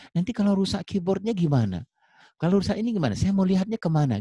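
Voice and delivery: high-pitched mock complaint; insistent customer. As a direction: press the point as a worried buyer, pitch up. Acted customer insisting on manual. (pembeli protes, nada tinggi)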